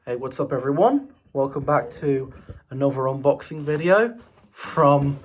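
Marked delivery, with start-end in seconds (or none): none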